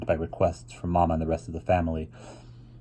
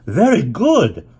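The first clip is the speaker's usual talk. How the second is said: high, loud, slow praise; drawn-out, clapping-hands enthusiasm; delighted cry; 10 semitones higher, 7 dB louder, much slower than usual. A narrator's reading. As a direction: cheer the child on with delight, much higher and louder. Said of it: "clapped her hands and cried".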